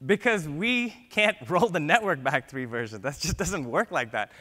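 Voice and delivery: amused, half-laughing; laughing mid-sentence. Real, not acted. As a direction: laugh through the line at how silly it is. Laughing at the absurd request.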